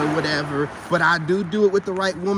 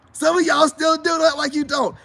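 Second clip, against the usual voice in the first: raised, high-pitched calling-out; insistent shout; 8 semitones higher, 5 dB louder than usual. Real, not acted. Man telling viewers not to act innocent, shouting. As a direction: shout it at them accusingly. Repeats accusation at male viewers.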